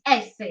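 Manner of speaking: "S" said in two syllables, "ESS-eh"; "S" with an added "eh" at the end; Italian letter name. Shown as a demonstration. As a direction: say "S" as Italian esse. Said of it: "It's pronounced S".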